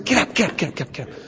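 embarrassed urgent hush to a kneeling friend; retold whisper. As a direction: plead him up off his knees in a hush. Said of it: "I'm, like, whispering".

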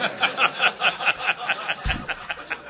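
loud nervous laugh breaking out; burst of laughter. Real, not acted. Sponsor recalls being stuck with a raging man, laughing. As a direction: laugh out loud at the awkward spot.

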